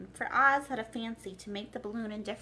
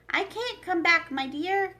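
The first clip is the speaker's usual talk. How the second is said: loud higher call down from above; 8 semitones higher, 7 dB louder than usual. Acted. Oz calls down to Dorothy from the rising basket, loud. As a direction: call back across the distance. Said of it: "called Oz from the basket".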